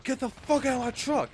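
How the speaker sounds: high-pitched mock yell at an intruder; imitated yelling. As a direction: yell it like the angry truck owner. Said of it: "he's just yelling at me".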